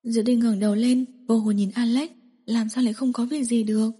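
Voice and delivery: plain reading voice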